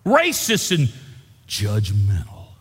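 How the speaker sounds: high, loud revival preaching; preaching at full force. Acted. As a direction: shout it out to the whole church. Preaching God's choosing at full force.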